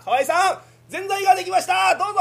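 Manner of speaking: high, loud, fast call of a name; announcing clerk. Acted. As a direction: call the customer's order. Official announces the order.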